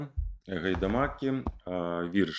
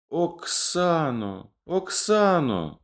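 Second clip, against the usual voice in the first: slow, higher cry of the name, building; barely uttered; 10 semitones higher, slower than usual. Acted. Yarema hears of his lost beloved, slow anguished cry. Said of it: "ледь вимовив Ярема".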